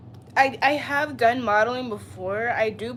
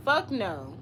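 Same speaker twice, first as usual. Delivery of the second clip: normal talking voice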